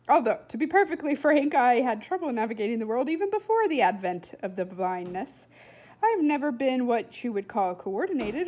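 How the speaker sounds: put-on loud, pompous boy philosophising; a know-it-all lecturing aloud; loud rambling boy. Blind boy musing to Flora, loud and grand.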